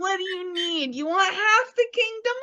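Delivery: high, doting sweet-talk; lovesick king. Retold or imitated. King cooing to his beloved wife. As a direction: coo at his sweetheart like a smitten fool.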